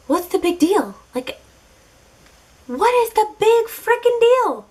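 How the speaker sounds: exasperated, climbing to a high frickin' deal. Retold or imitated. Brushing off her date's allergy, rising exasperated whine.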